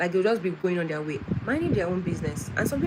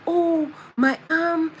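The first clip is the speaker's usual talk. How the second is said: imitating teacher's complaints; 6 semitones higher than usual. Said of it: "she says to tell me".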